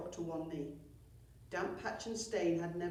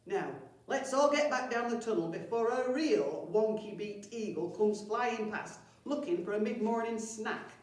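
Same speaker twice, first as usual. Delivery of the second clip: bossy goblin chief; 4 semitones higher, 6 dB louder than usual. Acted. Chief orders goblins back.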